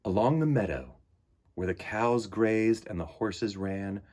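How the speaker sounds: unhurried picture-book narration; storybook reading. Acted. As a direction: read it like story time for little ones. Reads picture book aloud.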